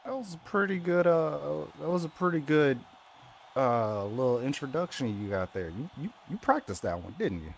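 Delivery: loud, fast mocking jab; sarcastic taunt. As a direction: needle him with sarcasm. Sarcastic jab at opponent's intro.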